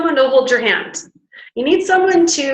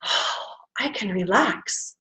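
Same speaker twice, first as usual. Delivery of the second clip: softer, slow relieved sigh of words; 3 semitones lower, 10 dB quieter, slower than usual. Retold or imitated. Nerves telling the brain it can relax. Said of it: "they tell your brain".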